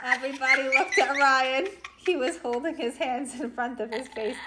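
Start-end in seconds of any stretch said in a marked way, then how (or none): none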